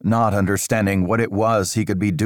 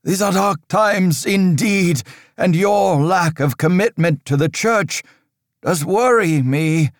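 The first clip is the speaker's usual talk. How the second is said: a higher, louder stern priest's voice, measured and grave; stern old priest; 12 semitones higher, 4 dB louder than usual. Acted. Father Riker warning Aldous of dark times, stern and raised. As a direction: lecture the boy on dark times like an anxious cleric.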